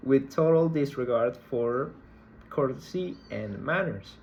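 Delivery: ordinary talk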